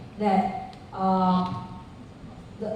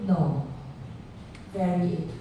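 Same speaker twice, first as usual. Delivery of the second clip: ordinary delivery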